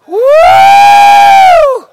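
high, loud whoop of joy; joyful whoop. Real, not acted. Preacher whoops to the church, high and loud. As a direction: let out a shouted woo.

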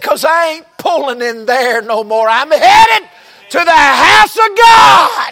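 loud and high, climbing to a shout; shouting praise. Retold or imitated. Woman quoted shouting her joy in the preacher's office. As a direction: belt it out like a church shout. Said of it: "She's shouting in the office".

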